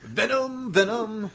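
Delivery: high, laughing shout of the title; excited shout. Real, not acted. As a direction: yell it out with glee. Shouted answer on count of three.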